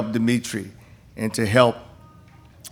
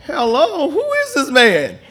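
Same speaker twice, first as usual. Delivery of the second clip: mock surprise; 12 semitones higher, slower than usual. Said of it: "I'm going".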